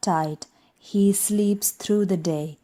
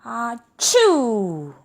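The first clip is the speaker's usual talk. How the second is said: big sneeze; 4 semitones higher, 4 dB louder than usual. Acted. Bear sneezes.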